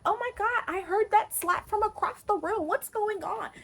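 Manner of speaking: put-on girl from school asking what happened, high nosy voice; high girly gossip voice. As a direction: play the nosy friend gasping for the tea.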